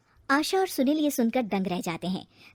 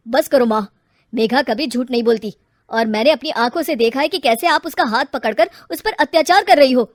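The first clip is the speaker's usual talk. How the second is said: firm rebuke; 8 dB louder than usual. Acted. Son confronts his mother.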